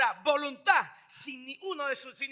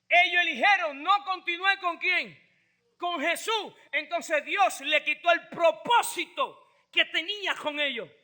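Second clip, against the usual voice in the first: ordinary talk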